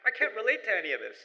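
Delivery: the new generation shrugging off the past, impatient; dismissive youth. Retold or imitated. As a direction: brush it all off like a bored Canadian-born kid. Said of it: "they're like".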